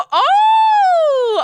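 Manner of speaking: high squeal of delight; excited shout. Real, not acted. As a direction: shriek with joy. Excited reaction to Vivian.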